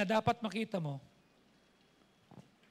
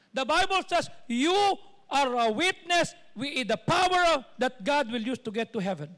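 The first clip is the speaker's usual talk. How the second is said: loud, emphatic scripture proclamation; forceful sermon delivery; full-force preaching; 4 semitones higher, 9 dB louder than usual. Real, not acted. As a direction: proclaim it to the whole congregation.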